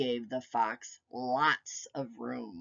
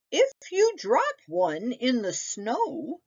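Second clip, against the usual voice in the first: grandmother's higher, cautioning voice; warning; 8 semitones higher than usual. A narrator's reading. Baba warning Nicky about losing mittens, cautioning. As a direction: warn the boy gently as Baba. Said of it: "she warned".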